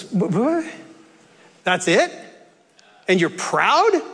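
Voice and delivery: halting, rising incredulous questions; stammer, then question each point in disbelief; baffled disbelief. Acted. Rich man to Jesus on seeing the shed, baffled. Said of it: "And the guy looks and says".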